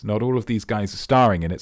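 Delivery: ordinary talk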